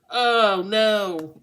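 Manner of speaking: mock dismay, played up loud. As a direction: groan it out like bad news just arrived. Acting reaction to unwanted text.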